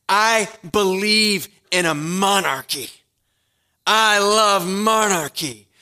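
loud, ringing sermon climax; preaching at full force. Real, not acted. Preacher rallying the congregation to their true king, full-force preaching.

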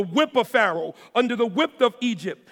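high, loud preaching at full force; building sermon delivery, loud and high; fiery preaching. Acted. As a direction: preach it out to the congregation, hitting every word.

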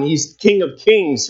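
normal talking voice

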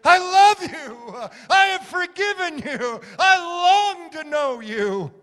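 high, loud, drawn-out declaration; preacher crying out Jesus's love at full force. Acted. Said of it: "He cried out".

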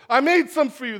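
high, loud mock-cheery offer; hammed-up eager mom with a treat; sweet mom voice. Mom luring kids to onion apples, loud cheery.

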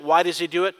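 ordinary delivery

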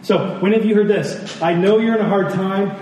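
ordinary talk

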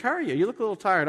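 lightly voiced coworker, pitched high; concerned coworker. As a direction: chirp a greeting like a coworker. Acted coworker's greeting at work.

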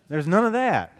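normal talking voice

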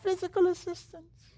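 very high, thin proper English voice; a stiff, overly polite plea; prim Englishman. Retold or imitated. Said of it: "so he was very proper".

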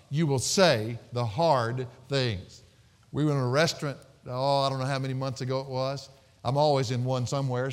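usual speaking voice

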